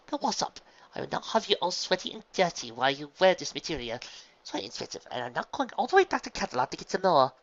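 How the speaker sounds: high, loud fussy scold; fussy big sister. A narrator's reading. Big sister fussing at her sweaty little sister, high and loud. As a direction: lecture her haughtily.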